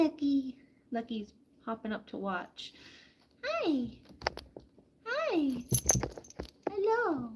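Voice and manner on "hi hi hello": sing-song high hello to the bird; high pet greeting. Real, not acted. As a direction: coo up at the bird.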